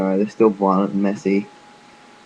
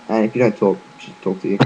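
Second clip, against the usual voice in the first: ordinary delivery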